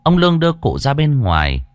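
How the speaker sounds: ordinary delivery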